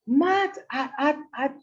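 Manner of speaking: loud, high mock yell. Stranger scolding a mother in public, yelling. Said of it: "she starts yelling at this woman".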